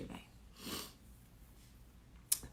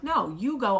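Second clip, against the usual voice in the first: higher, louder retold protest; insistent objection; 5 semitones higher, 6 dB louder than usual. Wife stopping Michael from stepping in, insistent. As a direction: cut in firmly, pitch jumping up.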